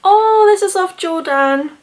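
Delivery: high, loud happy surprise; pleasant surprise. Real, not acted. Finding the gift is from Jordan, happily surprised. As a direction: sound touched and surprised.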